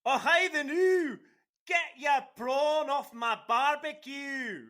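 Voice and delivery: high, slow hammed-up Australian shouting; bad parody of an Australian accent; mock Australian accent. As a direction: overblown fake Australian accent, almost yelled. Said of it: "let me show you exactly what an Australian sounds like".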